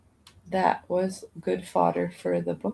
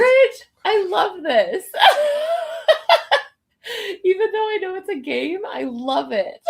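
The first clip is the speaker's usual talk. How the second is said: high squealing laugh through words; 12 semitones higher, 7 dB louder than usual. Real, not acted. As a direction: giggle while talking.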